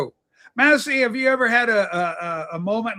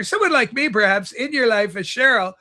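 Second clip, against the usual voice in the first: ordinary talk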